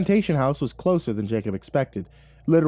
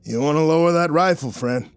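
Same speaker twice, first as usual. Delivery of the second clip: growled; 6 semitones higher, 5 dB louder than usual. Acted. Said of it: "Jacob growled".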